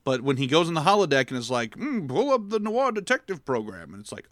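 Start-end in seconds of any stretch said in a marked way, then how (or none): 1.8-3.9 higher, lilting put-on command; Picard. Picard asking the holodeck for noir, put-on voice. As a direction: order it up with mock grandeur.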